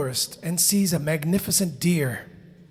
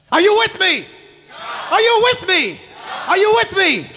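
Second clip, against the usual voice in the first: high, loud shout to the crowd; rally the audience with a big yell; calling out; 12 semitones higher, 8 dB louder than usual. Real, not acted. Calls out to the audience.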